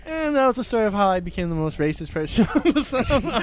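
words breaking up with laughter; laughing. Real, not acted. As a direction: stumble through the punchline, laughing. Cracking up at own joke.

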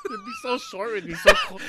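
high laughing words; laughing through it. Real, not acted.

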